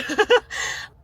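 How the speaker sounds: high, nervous burst of laughter. Real, not acted. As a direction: laugh off the scare. Nervous laugh after the scare.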